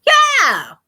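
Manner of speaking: a wild hollered yeah; imitated scream. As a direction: mimic a famous campaign scream. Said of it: "him hollering and screaming like".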